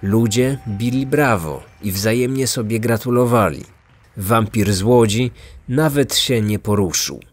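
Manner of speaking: plain reading voice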